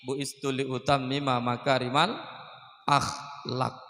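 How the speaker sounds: ordinary delivery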